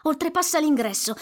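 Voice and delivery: ordinary talk, nothing marked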